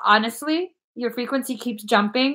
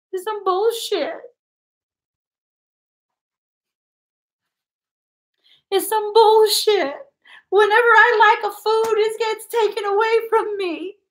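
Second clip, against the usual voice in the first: high whining complaint; 11 semitones higher than usual. Real, not acted. Psychic complaining to client about losing foods, high whine.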